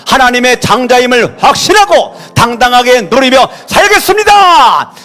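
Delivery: shouted vows, high and loud; loud prayer. Real, not acted. Pastor leading the church in shouted vows. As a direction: declare each vow as a fervent cry. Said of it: "큰 소리로 시작".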